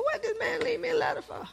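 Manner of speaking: high, laughing disbelief; incredulous. Retold or imitated. Wife finding her husband's letter, laughing in high disbelief. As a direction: squeal it out in amused disbelief. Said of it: "I said".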